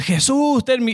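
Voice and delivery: high cry, cut off midway; pleading call. Acted. Blind man calling Jesus over those against him, pleading call. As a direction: call out insistently for mercy.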